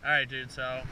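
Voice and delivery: normal talking voice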